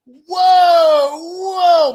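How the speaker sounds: high, loud startled cry; hammed-up alarm to calm mom; alarmed whoa. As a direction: son stopping the panic. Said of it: "I'm like".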